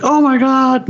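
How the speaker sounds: very high mock gasp of alarm; retold shocked exclamation. Mom spotting the snake by her child, high shocked exclamation. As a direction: imitate mom's shock, pitch leaping up.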